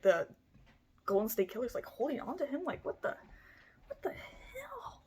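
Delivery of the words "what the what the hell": very high, slow whispered disbelief; shocked whisper. Real, not acted. Narrator whispers in horror at the shooting. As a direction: breathe out a stunned what the hell.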